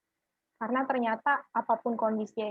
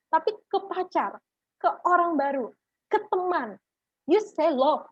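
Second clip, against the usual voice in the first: rising reproach; 6 semitones higher, 4 dB louder than usual. Real, not acted. Scolding youth, rising pitch.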